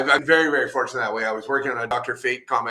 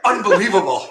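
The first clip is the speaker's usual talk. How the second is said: excited; 7 semitones higher, 8 dB louder than usual. Retold or imitated. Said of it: "I'm just ready to explode".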